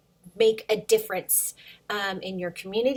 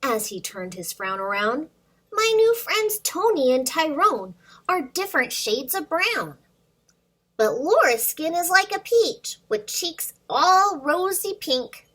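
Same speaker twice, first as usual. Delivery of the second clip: put-on lively storybook read-aloud for little kids; reading to children; 3 semitones higher, 4 dB louder than usual. Teacher reads a picture book to her students, lively and animated. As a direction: bring the picture book to life for the class.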